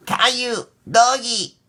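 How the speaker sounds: put-on high, excited toddler voice; toddler Rosie exclaiming. Rosie seeing the toy dog, excited toddler voice. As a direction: squeal it like a thrilled toddler. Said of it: "Rosie exclaimed".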